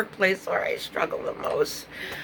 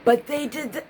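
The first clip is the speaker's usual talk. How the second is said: raised, insistent objection starting with but; high objecting voice; 6 semitones higher, 4 dB louder than usual. Retold or imitated. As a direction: push back with a loud but. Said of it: "leave out that "but".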